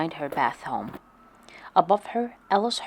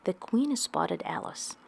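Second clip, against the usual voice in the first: usual speaking voice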